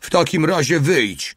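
high-pitched called command; shouted order. A narrator's reading. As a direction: call out from a distance. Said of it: "krzyknął mały". (wykrzyczane wysokim głosem polecenie)

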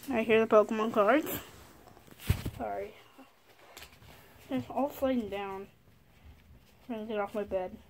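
ordinary talk

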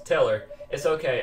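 ordinary talk, nothing marked

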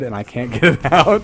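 giggling as he speaks; words breaking into a laugh; can't keep the laugh out of it; laughing through words. Real, not acted. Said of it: "this little accent going on in my head".

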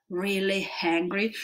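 ordinary talk

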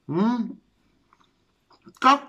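talking between bites; mumbling around food; mouth full, chewing. Real, not acted. Seen 1: Takes forkful of omelette at 0.1, chews visibly while speaking.

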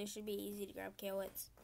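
usual speaking voice

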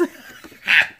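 loud giggle of delight; real, gleeful chuckle; delighted laugh. Real, not acted. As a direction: burst out in a short laugh. Delighted by the cutouts.

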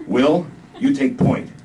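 slow, loud command; lieutenant ordering Will, loud and slow; lightly voiced officer order. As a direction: give the order slowly.

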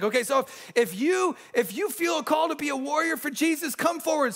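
rally the boys forward, gently mocking; youth camp speaker. Mimics speaker's altar call.

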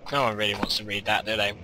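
ordinary delivery

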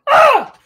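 a sharp, high yell imitated to scare someone; sudden yell. Scaring the girl at the restaurant table with a high yell. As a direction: burst out with a startling high shout. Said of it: "and all of a sudden I go".

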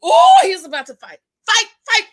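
loud, high fight call; excited egging on. Real, not acted. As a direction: cheer on the fight like a bystander.

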